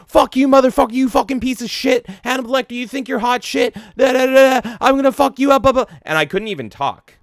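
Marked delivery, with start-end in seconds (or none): 0.1-5.9 imitating a small teen shouting high-pitched threats, much higher and louder; squeaky trash-talking boy screaming curses; aggressive kid's yell. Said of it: "He was just so loud and so fucking aggressive".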